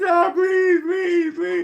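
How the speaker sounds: high, whiny pleading to God; mock begging prayer. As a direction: beg God in a high mocking whine. Mock begging after years.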